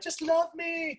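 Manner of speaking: very high, slow dog voice; squeaky, drawn-out pet voice; pleading dog. Acted. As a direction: draw it out like a needy pup. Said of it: "the dog's gonna be like".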